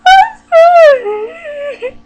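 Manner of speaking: high, loud hammed-up sob-wail; teenage girl bawling at the counter; mock wail. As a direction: burst into a comic crying howl. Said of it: "This is what I did".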